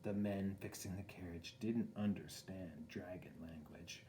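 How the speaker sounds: whispered narration. A narrator's reading.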